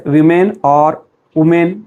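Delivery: "women" slowly as wi-men, then as woo-men; "women" ending in men both times; wrong women. Shown as a demonstration. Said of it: "not women or women".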